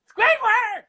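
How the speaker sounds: very high, loud cartoon call; piercing sponge holler; SpongeBob. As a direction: squeal out for your cartoon neighbour.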